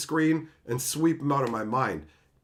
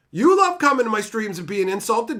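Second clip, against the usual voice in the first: usual speaking voice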